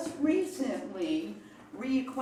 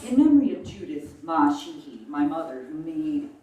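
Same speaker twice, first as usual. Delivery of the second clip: measured memorial poem reading, louder than chat; poetry recitation; 9 dB louder than usual. Acted. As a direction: recite deliberately, phrase by phrase, with pauses. Reads own memorial poem to music.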